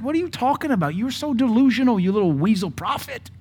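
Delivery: high-pitched, exasperated mock scolding; incredulous rebuke. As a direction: talk him out of his self-pity in a high, disbelieving voice. Mocking rebuttal to self-pitying prophet.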